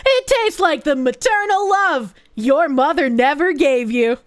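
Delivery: hammed-up TV pitchman; shill voice. Streamer pitching gamer subs to fellow gamers, shill voice. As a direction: sell it big, like an infomercial shill. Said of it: "Shill voice".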